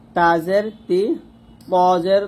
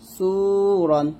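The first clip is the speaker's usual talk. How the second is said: normal talking voice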